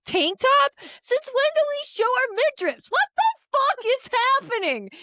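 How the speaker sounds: mock outrage. Stylist scolding her friend's outfit choice, high mock outrage. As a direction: shriek it like a scandalised stylist. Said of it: "Is how that went".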